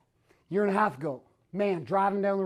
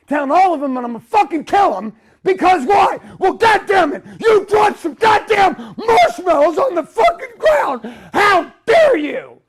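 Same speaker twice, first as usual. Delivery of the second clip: much higher, much louder raging yell, slowing on "How dare you"; furious shout; 10 semitones higher, 11 dB louder than usual. Retold or imitated. Man at teenage boys over marshmallows, screaming in rage. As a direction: explode at the kids at full volume. Reenacts grocery store rage.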